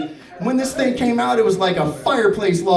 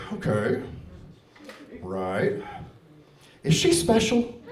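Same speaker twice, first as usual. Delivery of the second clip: quiet, halting imitation of a doctor; low, careful start edging up into a question; wary doctor; 8 semitones lower, 6 dB quieter than usual. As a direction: feel out each phrase with pauses. Imagined doctor carefully reacting to story.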